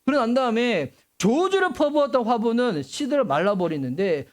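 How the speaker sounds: ordinary delivery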